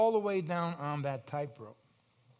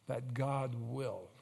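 ordinary talk

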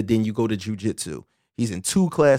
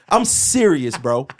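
usual speaking voice

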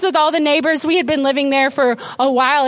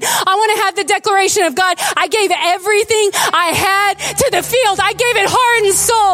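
raised, fervent pulpit voice; impassioned preaching; 5 semitones higher than usual. Acted. Pastor urges congregation to give everything, fervent. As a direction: pour it out like a prayer.

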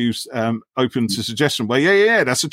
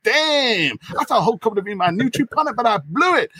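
high hammed-up regret; mock heartbreak; 12 semitones higher than usual. Scotty's heart lamenting Hope, high mock regret. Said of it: "his heart's all like".

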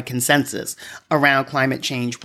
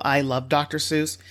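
normal talking voice

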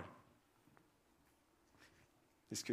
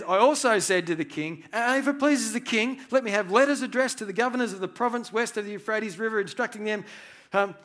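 higher, louder, eager pleading; fast, raised, excited petition; bold request to the king; 8 semitones higher, 8 dB louder than usual. Retold or imitated. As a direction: press every request with rising nerve.

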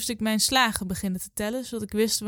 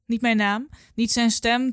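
plain reading voice

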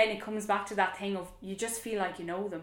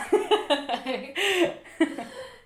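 short happy laugh; bright laugh; 8 semitones higher, 5 dB louder than usual. Real, not acted. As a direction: laugh at yourself. Laughs at own sport obsession.

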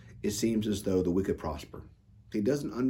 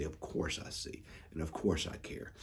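whispered, hushed reassurance; God whispering reassurance to His suffering people; tender whisper; 5 semitones lower, 12 dB quieter than usual. Acted. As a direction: breathe it out as God comforting. God answers the complaining people.

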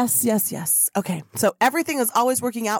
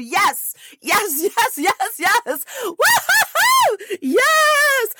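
laughing, much higher, louder whoops of joy; ecstatic cheering; 11 semitones higher, 5 dB louder, much slower than usual. Real, not acted. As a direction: cheer like the room just exploded. Ecstatic cheering after the chant.

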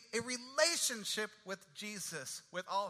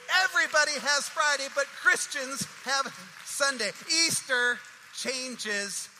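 loud, higher proclamation, slowing on Easter changes; emphatic proclamation; 4 semitones higher, 8 dB louder than usual. Real, not acted. Preacher proclaiming Easter's meaning to the congregation, loud and emphatic. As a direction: proclaim it to the whole room. Climax of own sermon.

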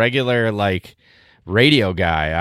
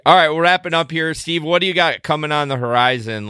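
ordinary talk, nothing marked

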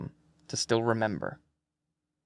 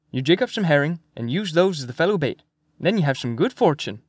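put-on lilting old fisherman, phrases tipping upward; old Swede, questioning lilt; 3 semitones higher than usual. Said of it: "His voice went up at the end of every sentence".

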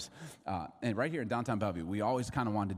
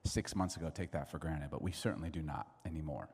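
low, soft, earnest prayer; softly spoken congregational prayer; 6 semitones lower, 8 dB quieter than usual. Real, not acted. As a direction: pray quietly and reverently, in hushed low tones. Opening prayer before the talk.

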